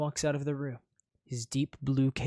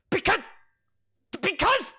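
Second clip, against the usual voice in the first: flustered stammer; 7 dB louder than usual. Acted. Prince stammers, face red.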